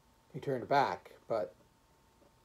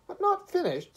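very high, loud repeat; mocking, high-pitched astonishment; incredulous echo; 8 dB louder than usual. A narrator's reading. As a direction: throw it back in loud disbelief.

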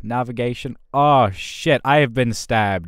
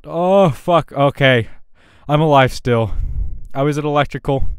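surprised relief; 4 semitones higher, 6 dB louder than usual. Real, not acted. Surprised he is still alive.

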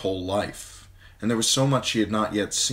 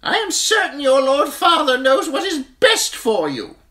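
stern septa; 10 dB louder than usual. A narrator's reading. Septa Mordane scolding the weeping girl, high and stern. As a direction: snap the girl out of tears. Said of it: "Septa Mordane said sternly".

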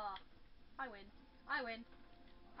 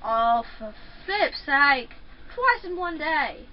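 exasperated outburst; 7 dB louder, slower than usual. Real, not acted. Exasperated at dying again.

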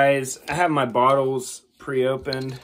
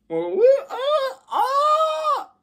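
sharp high cries of pain; yelping as the spray hits; pained high yelps; much slower than usual. Real, not acted. Reacting to pepper spray.

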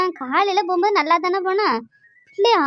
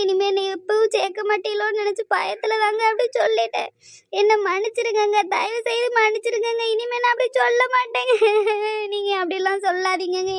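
tearful pleading. Acted. Wife begs husband not to leave her, crying. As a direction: beg forgiveness through sobs. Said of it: "அழுது நாடகம் போடாத டி".

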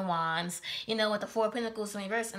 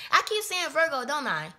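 flustered exclamation; 5 semitones higher, 6 dB louder than usual. Real, not acted. Laughing at own slip.

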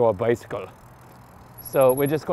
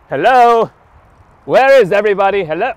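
loud, higher-pitched call for anyone around; 6 semitones higher, 11 dB louder than usual. Real, not acted. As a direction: shout out to find someone. Calling out on an empty street.

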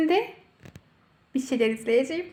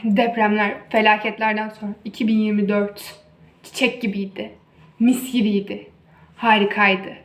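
mock solemn farewell speech; formal speech; 4 semitones lower than usual. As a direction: deliver it like a grand ceremony.

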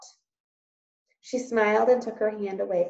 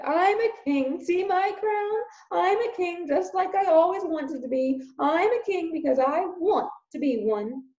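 mock king; 9 semitones higher than usual. Uncle pretends to be king.

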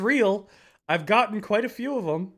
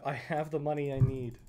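ordinary talk

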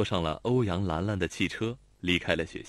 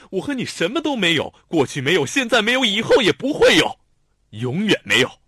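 much louder, higher shouted denial; furious denial; 9 semitones higher, 10 dB louder than usual. A narrator's reading. Xiao Tong shouts his denial at Lanlan, furious. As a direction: shout down every word. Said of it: "手足无措地骂".

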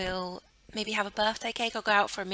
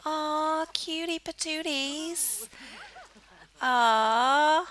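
high, drawn-out "aw"; adoring coo; 7 semitones higher, 4 dB louder, much slower than usual. Real, not acted. Player gushing over cutie patooties, adoring coo. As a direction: coo slowly over something adorable. Cooing over cute characters.

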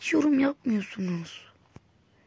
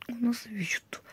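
whisper; 11 dB quieter, faster than usual. Real, not acted. Disbelief at a shot.

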